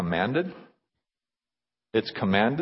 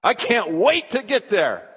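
higher-pitched, eager announcement; joyful excitement; 9 semitones higher, 4 dB louder than usual. Acted. Proud churchgoer telling a friend about Sunday, excited. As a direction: bubble over with proud anticipation. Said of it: "Is there joy and excitement".

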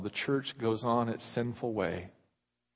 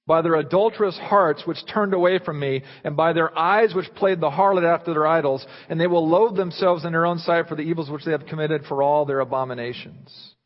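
louder, higher, forceful scripture reading; preaching scripture; 5 semitones higher, 9 dB louder than usual. Acted. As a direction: proclaim the verses to the whole hall. Reads scripture at full force.

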